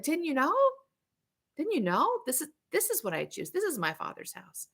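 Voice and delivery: put-on a gentle teenager calmly explaining himself; soft teen. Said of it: "my own seventeen-year-old and just going like".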